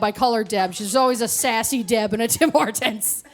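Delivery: ordinary talk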